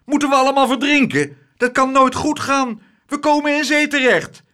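high, loud panicked tirade; frantic outraged yelling; shouted. A narrator's reading. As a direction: berate him in rising panic. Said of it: "schreeuwde meneer Tump".

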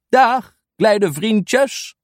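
trage, hoge oudemannenstem; oude man. Acted. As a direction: groet de kindjes als een oude heer. Oude man begroet kinderen. (oude meneer groet de kinderen met trage stem)

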